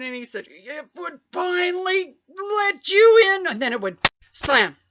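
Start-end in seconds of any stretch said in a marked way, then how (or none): 0.5-3.5 higher, louder, slow drawn-out relief; slow and dramatic. Retold or imitated. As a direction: stretch it out like a triumph.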